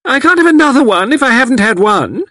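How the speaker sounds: put-on calling out very high and loud like a tiny old lady; demanding cocoa in a high, loud, childish wail; little old woman loudly calling for her cocoa; loud shrill old woman. A narrator's reading. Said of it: "loud, shrill voice".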